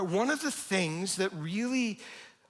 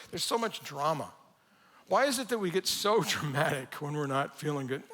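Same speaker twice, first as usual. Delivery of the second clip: ordinary delivery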